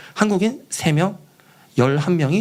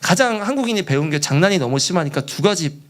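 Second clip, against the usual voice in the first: normal talking voice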